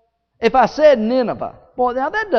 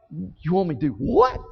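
incredulous Jonah; 5 semitones lower, 6 dB quieter than usual. Acted. Jonah's reluctant reply.

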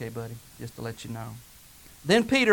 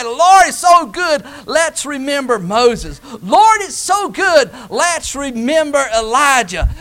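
higher, louder, slow and pleading; Simon Peter's eager plea; 6 semitones higher, 10 dB louder than usual. Acted. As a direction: beg the Lord to make the moment last. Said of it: "just like Simon Peter".

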